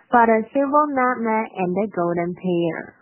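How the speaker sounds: line read through in a connected flow. Shown as a demonstration. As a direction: pick up the pace and link the words. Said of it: "我同样是先快再慢这块".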